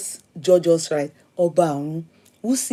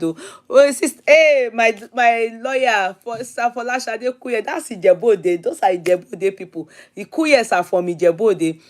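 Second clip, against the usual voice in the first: ordinary delivery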